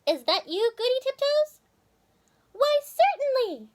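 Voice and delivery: high, pleased squirrel voice; Timmy replying. Acted. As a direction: answer up from the hole, high. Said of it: "Timmy replied".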